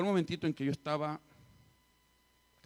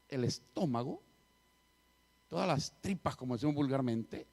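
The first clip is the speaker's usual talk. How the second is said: ordinary talk, nothing marked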